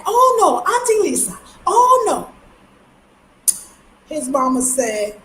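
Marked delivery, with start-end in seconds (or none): 0.0-2.3 put-on high, whiny little boy tattling to his aunt; upset nephew. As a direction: pitch it way up like a kid telling on someone. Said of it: "And so he got mad".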